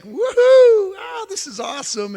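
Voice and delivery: high whooping cheer that settles into glee; a sudden high woohoo of delight; thrilled whoop. Retold or imitated. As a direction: whoop it like the shot just worked. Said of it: "I'm just thrilled".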